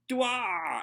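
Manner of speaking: high, loud cartoon attack yell; squeaky battle yelp; high effort cry. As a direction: grunt as you launch the attack.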